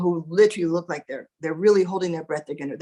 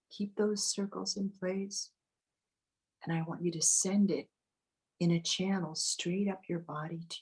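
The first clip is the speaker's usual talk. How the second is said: guided meditation; 10 dB quieter than usual. Acted. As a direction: ease them into calm, close and gentle. Leads a meditation.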